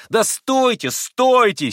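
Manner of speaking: high, loud plea to wait; straining to outshout everyone. A narrator's reading. Boy silencing his cheering friends, loud and urgent. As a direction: shout over the noisy crowd. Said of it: "силился всех перекричать Красоткин".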